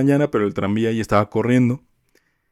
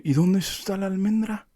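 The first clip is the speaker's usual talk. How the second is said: picky customer, pitched high; high, demanding customer voice; 10 semitones higher, 5 dB quieter than usual. Retold or imitated. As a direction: play the complaining lady, high and pushy. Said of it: "Y me dice". (clienta quejumbrosa con voz aguda)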